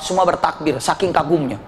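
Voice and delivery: ordinary delivery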